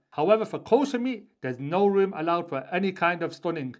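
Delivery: usual speaking voice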